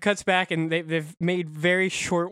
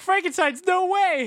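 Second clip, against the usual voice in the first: excited disbelief; 11 semitones higher than usual. Retold or imitated.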